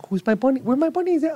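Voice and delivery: put-on very high cooing baby voice; baby talk. Dad at home cooing at his daughter, very high baby talk. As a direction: coo it sky-high to the little one. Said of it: "and I'm like".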